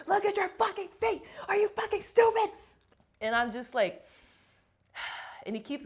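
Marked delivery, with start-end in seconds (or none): 0.1-2.5 high, loud imitated drill-instructor scream. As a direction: scream it right in a recruit's face. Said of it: "he starts screaming in my fucking face".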